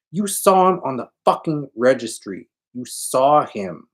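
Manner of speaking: high, loud accusing; Tony insists she saw him, angry accusing; angry insistence. Acted. Heated blaming.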